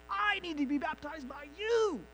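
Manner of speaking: lightly voiced John protesting to Jesus; mild insistent quote; emphatic plea. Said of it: "It's like an emphatic".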